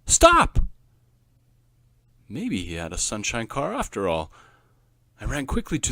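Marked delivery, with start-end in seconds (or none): 0.0-0.6 loud high yell; shouted stop. A narrator's reading. Boy shouting stop at his car, loud. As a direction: bark it like a command.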